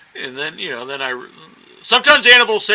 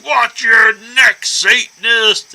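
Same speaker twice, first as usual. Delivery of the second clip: slow, drawn-out threatening yell, pitched up and loud; angry stranger yelling; 4 semitones higher, 3 dB louder, much slower than usual. Retold or imitated. Stranger in Boston yelling at her over a horror shirt, slow menacing shout. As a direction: bellow each word like a street thug picking a fight. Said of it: "He's yelling at me".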